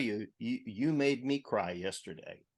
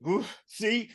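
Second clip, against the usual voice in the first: choked up, voice jumping high and loud; fighting back tears as emotion breaks through; real emotional crack in the voice; 11 semitones higher, 8 dB louder than usual. Real, not acted. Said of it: "without my voice cracking".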